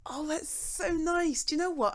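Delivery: ordinary talk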